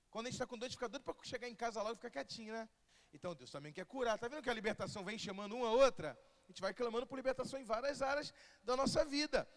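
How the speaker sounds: normal talking voice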